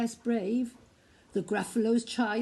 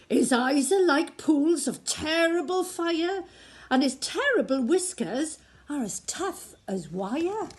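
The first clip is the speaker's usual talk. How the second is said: Gruffalo's voice; 6 semitones higher, 4 dB louder than usual. Acted. Gruffalo describes the mouse.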